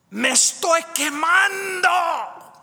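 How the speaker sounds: high, loud, slowed anguished shout; retold scream. Patient screaming from her hospital room, high anguished shout. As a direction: cry out as if burning alive. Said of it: "a voice scream".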